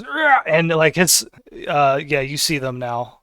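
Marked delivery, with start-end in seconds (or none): 0.0-0.4 creature roar. Acted. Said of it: "He goes".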